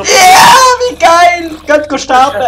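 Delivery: slow high ecstatic yell; ecstatic shout. Real, not acted. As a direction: scream with delight. Cheers at zombie chaos.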